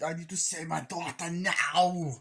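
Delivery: hammed-up action hero, final word stretched; mock hero urgency. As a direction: imitate a desperate movie dad, drawing out NOW. Hero insists on saving daughter.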